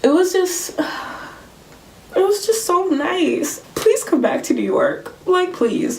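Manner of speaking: high, loud, emotional pleading; overwhelmed gushing. Real, not acted. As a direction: gush with a sigh, then beg. Overwhelmed, begs band to return.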